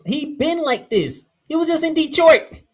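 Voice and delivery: excited. Real, not acted. Hyped defense of Stafford.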